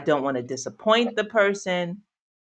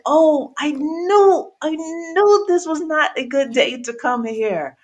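high, slow regretful groan; exasperated; 10 semitones higher, 3 dB louder than usual. Retold or imitated. To herself arriving in the rain, high exasperated regret. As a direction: drag out the "I knew" in high regret. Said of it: "but it was like".

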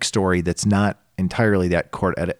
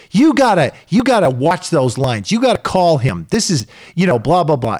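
high, loud mock coaching of her son; imitating the bossy mom; 8 semitones higher, 4 dB louder than usual. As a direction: bark orders like a pushy sideline parent. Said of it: "then she says".

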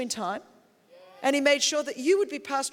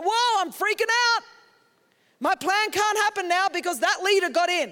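higher, louder hammed-up freak-out; mock-frantic whine, pitched up; mock panic; 6 semitones higher, 4 dB louder than usual. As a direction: pretend to lose it over the election. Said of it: "God's not like".

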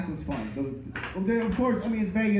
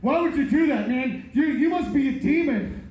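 high, frantic accusing voice; panicked and pleading; freaked out; 6 semitones higher than usual. Retold or imitated. Freaked passenger accuses him.

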